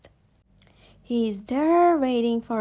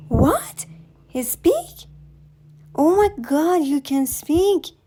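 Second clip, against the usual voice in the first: stunned disbelief; 6 semitones higher, 3 dB louder than usual. Acted. Mother stunned her son speaks.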